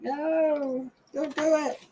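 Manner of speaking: high, mock-desperate plea; retold pleading. Retelling her plea to the friend.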